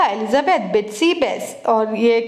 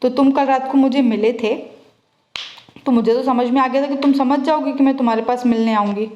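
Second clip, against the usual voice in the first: ordinary talk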